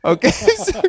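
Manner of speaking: high laughing okay, amused. Real, not acted. Laughing at egg freezing ads.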